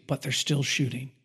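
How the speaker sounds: normal talking voice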